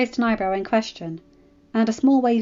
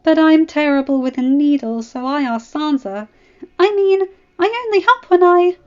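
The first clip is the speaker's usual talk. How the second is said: put-on girl rushing to explain, higher; jumping in to share the blame; eager girl voice; 7 semitones higher, 8 dB louder than usual. A narrator's reading. Daenerys voiced, defending friend.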